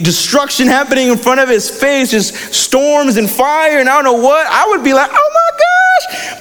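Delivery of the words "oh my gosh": very high, drawn-out squeal; mock panic. Preacher imagining himself facing storms and fire, mock panic. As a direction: squeal it slowly in hammed-up alarm.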